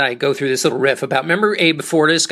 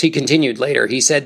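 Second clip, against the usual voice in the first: ordinary talk, nothing marked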